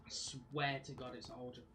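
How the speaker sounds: ordinary delivery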